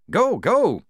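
high, loud guard's order; urgent shout. Acted. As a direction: shout to push them forward. Guard urging Polly out.

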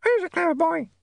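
very high parrot squawk. A narrator's reading. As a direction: mimic like a talking parrot. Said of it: "said the dog".

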